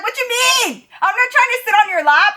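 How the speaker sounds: high-pitched, hammed-up indignant outburst; flipping out. Waitress refuses to sit on a customer's lap, flipping out. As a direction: squeal the question, then rush the protest. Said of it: "I was flipping my shit".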